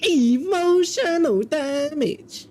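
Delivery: high, very drawn-out mock wail; hammed-up catchphrase. Repeating a catchphrase for friends, high drawn-out mock wail. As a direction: stretch it out high, without shouting. Same catchphrase again, less loud.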